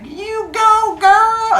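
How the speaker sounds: very high, loud kitten cheer; yelling kitten. Acted. Teacher voicing a motivational kitten picture, high imitated yell. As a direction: yell it as a squealing cartoon kitten cheering someone on. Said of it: "kitten who is yelling at you to go".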